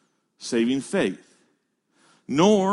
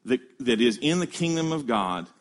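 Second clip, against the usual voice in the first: normal talking voice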